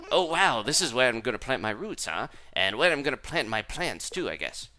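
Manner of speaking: fancy cat character voice. Acted. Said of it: "gotta do some live voices here".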